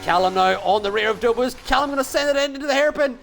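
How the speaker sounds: rising to a high, fast call; excited commentary. Real, not acted. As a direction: build the call as contact happens. Overtake and contact, rising excitement.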